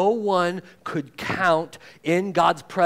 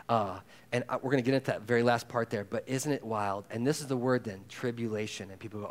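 normal talking voice

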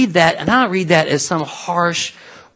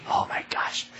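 whispered aside; conspiratorial whisper; 12 dB quieter than usual. Real, not acted. Preacher whispering a joke about convention crowds.

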